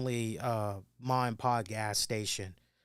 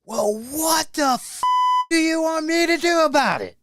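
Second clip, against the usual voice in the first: high, loud, furious retold scream; father-in-law screaming at the gas station attendant; screaming rage; 14 semitones higher, 11 dB louder than usual. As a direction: yell it at the top of your lungs. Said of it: "screams at this guy at the top of his lungs".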